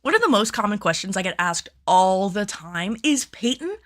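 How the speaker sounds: bright upbeat host read; cheerful on-camera presenter voice; presenter intro read. Acted. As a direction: sell the intro like a YouTube host. Final take of the intro.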